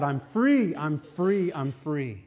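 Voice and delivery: imitated yell of release, tapering off; retold joyful yell. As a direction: imitate him yelling out, fading with each repeat. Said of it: "he's yelling out".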